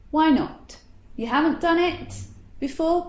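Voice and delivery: normal talking voice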